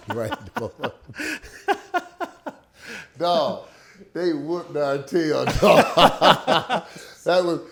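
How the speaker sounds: laughing through the words. Real, not acted. Laughing with the host about getting beaten. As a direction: chuckle while the words come out. Laughing at his rookie self.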